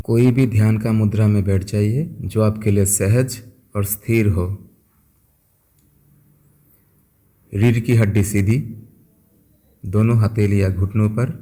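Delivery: soft, unhurried voice with long pauses; guided meditation. Acted. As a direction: guide listeners gently into stillness, phrase by phrase. (शांत, धीमे स्वर में ध्यान कराते हुए)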